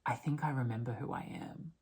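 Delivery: whispered in wonder. Retold or imitated. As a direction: breathe it as a soft realization. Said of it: "turns up and she goes".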